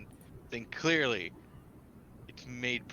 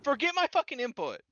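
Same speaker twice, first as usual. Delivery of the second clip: high, loud exasperated snap; exasperated, pitched way up; 6 dB louder than usual. Real, not acted. Exasperated outburst at the table.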